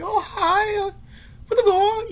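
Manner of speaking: high, squeaky mock of the shop lady's speech; mock broken English. Mocking the Chinese shop lady's squeaky broken English. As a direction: squeal it like a clumsy foreign shopkeeper. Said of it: "She couldn't speak really well English".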